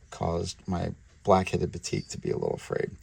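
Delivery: usual speaking voice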